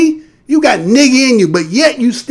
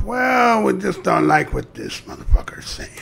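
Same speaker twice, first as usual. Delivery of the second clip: mock whisper; 9 dB quieter than usual. Mimicking outside sources sabotaging his video, whispered. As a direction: hiss it like the sneaky critics.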